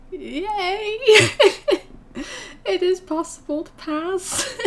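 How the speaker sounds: high, giddy laughter through the words; laughing with joy. Real, not acted. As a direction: laugh as you say it. Laughing with joy after passing.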